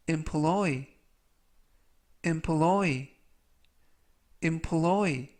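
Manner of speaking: "employ" with the stress on the last syllable "ploy". Shown as a demonstration. Teacher models "employ" for learners, stress on the end. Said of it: "라고 발음하시면 되겠고요".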